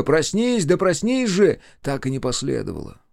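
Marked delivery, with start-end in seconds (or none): 0.0-1.6 high, louder pleading shout; urgent, raised-pitch appeal; desperate cry. A narrator's reading. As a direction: cry out to wake someone. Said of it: "Отчаянного крика".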